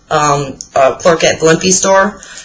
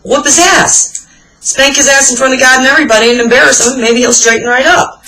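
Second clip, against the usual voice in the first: mock urging, higher and exasperated; hammed-up frustrated onlooker, pitched up; silent exasperated urging; 7 semitones higher than usual. Cashier silently urging the mother to spank her son, mock exasperated. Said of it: "I'm looking at her like".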